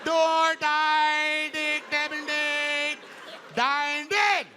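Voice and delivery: put-on high, sustained Indian-accented counting; mock Indian accent. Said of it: "Indians will put a D on anything".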